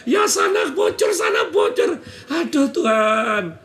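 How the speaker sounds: high, shouted mock panic; acted dismay. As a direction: cry out in alarm at leaks everywhere. Acting out panic at leaking roof.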